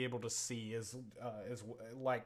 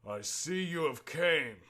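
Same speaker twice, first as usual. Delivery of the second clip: slow, louder dragon speech; slow, raised voice of a great beast; 4 semitones higher, 6 dB louder, slower than usual. Acted. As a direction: draw it out as a looming dragon. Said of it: "and then says".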